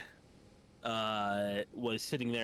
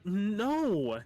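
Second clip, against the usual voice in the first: high, loud exasperated "No."; mock annoyed reply to a nagging aunt; 9 semitones higher, 5 dB louder than usual. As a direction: snap back a fed-up no. Retold annoyed answer to aunt.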